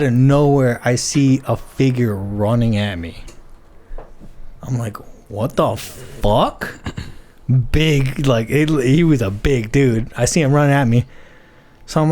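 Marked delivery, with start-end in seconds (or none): 5.3-6.7 low, drawn-out disbelief; baffled disbelief. Retold or imitated. Runner spots a big figure coming at him, baffled. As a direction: stretch it out, puzzled, without raising your voice. Startled on seeing someone running at him.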